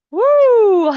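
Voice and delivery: high whooping cheer; excited cheer. Real, not acted. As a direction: let out a big celebratory woo. Cheer after finishing the set.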